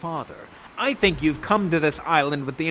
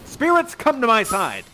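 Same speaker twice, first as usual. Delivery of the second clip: loud, high theatrical summons; summoning call; 4 semitones higher, 5 dB louder than usual. Acted. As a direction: call the spirits to heel. Prospero summons his spirits.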